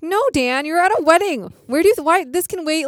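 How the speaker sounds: high, loud mock telling-off; exasperated scolding. Acted scolding of Dan.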